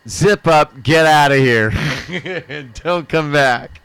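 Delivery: loud, slow mock order; cop dismissal. Cop letting the kid go, a loud slow order. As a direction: send him off with a gruff order. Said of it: "And he's like".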